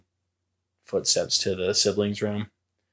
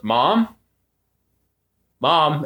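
calling up the stairs, raised; a raised call of Mom; 6 semitones higher, 4 dB louder than usual. Retold or imitated.

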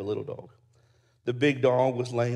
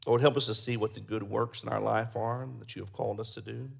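closing prayer; 3 dB louder than usual. Acted. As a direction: pray over the congregation.

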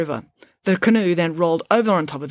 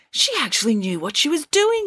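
high, happy shout of relief; excited yell; 9 semitones higher than usual. A narrator's reading. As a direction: cheer it out. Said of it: "yelled Y E".

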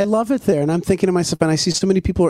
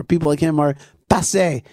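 ordinary talk

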